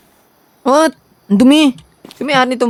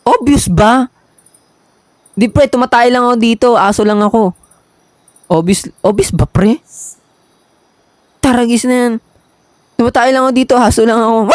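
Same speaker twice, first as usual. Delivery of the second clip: tough street guy; 7 semitones higher than usual. Pretend. Voicing the character's reply.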